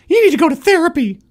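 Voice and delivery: high, loud and fast demand; demanding wife. Pretend. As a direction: snap it at him like a bossy wife. Acted skit, wife demands.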